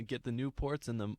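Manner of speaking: plain reading voice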